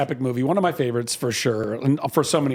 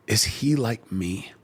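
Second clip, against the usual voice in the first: afraid; 5 semitones lower, much slower than usual. Retold or imitated. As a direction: ask it slowly and timidly, a touch of dread. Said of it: "And he's afraid".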